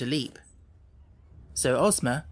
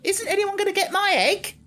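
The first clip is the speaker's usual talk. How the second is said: very high, loud excited cry; 4 dB louder than usual. A narrator's reading. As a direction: squawk it out in a flap. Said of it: "cried the hen in great excitement".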